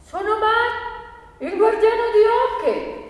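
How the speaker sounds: high, drawn-out call; proud announcement shouted high; loud, clear cry. Acted. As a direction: shout it out slowly for all to hear. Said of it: "gridare forte e chiaro".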